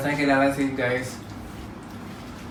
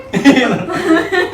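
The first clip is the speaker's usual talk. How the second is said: startled laugh; 9 semitones higher than usual. Real, not acted. Friends force him to eat bugs.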